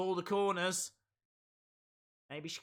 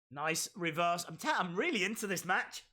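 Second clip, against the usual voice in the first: ordinary talk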